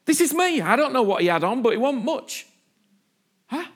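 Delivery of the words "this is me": raised, higher declaration; bold self-revealing Jesus. Acted. Jesus baring himself before the disciples, high and emphatic. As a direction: announce yourself openly, pitched up. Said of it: "took his clothes off and went".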